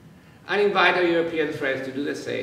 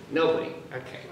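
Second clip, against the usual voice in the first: normal talking voice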